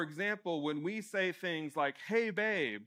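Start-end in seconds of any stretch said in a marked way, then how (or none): 2.1-2.8 lightly acted call for attention, higher; mock call to a partner, lifted pitch. Example of getting a partner's attention, calling out. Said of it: "I'm calling out to you, I'm getting your attention".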